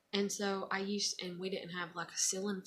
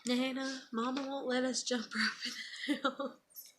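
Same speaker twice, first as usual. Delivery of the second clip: higher, playful little-grandchild whine with a laugh; mother teasing daughter in mock grandchild voice, higher and giggly; teasing grandkid voice; 6 semitones higher than usual. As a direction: mimic the grandbabies tattling, half laughing. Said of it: "like, teasing her".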